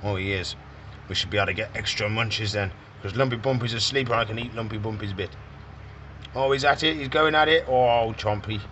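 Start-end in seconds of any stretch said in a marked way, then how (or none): 0.0-5.3 softer, sneaky tortoise plotting; lightly voiced tortoise whispering a plan; sly tortoise voice. Keeper voicing the tortoise sneaking food, softer and sly. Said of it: "He says".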